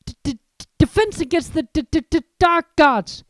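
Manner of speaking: high, nervous stutter; stammering mutter. Acted. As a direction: stammer reluctantly. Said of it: "muttered Professor Quirrell".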